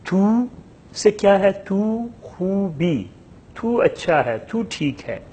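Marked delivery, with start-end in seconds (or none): 1.5-3.0 تو خوبی spoken slowly, higher, تو stressed; drawn-out teacher model of تو خوبی; slow Persian model. Shown as a demonstration. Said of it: "ہم تلفظ کر رہے ہیں". (تو خوبی کو آہستہ، اونچی آواز میں)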